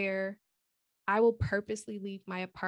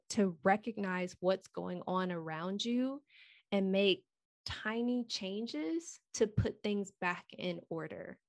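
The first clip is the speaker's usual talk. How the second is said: ordinary delivery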